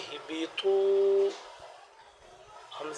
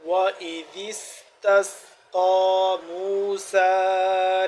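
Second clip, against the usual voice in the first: flowing, louder chanted recitation; fluent recitation; 2 semitones higher, 6 dB louder, much slower than usual. Shown as a demonstration. Teacher recites the whole passage fluently for students. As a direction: recite the passage in one smooth flow. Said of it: "روانگی کے ساتھ پڑھتے ہیں".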